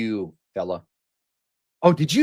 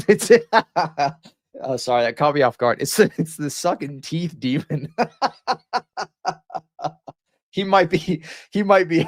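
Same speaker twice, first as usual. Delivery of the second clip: giggling through the words, pitched up; can't stop cracking up; laughing fit; 4 semitones higher than usual. Real, not acted. Streamer laughing at a clip in a laughing fit. Caught off guard laughing hard.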